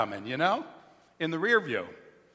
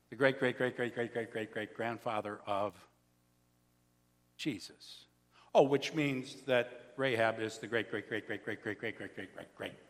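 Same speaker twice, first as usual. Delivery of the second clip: 1 fading list; 7 semitones lower, 9 dB quieter than usual. Acted. Long list of greats fading.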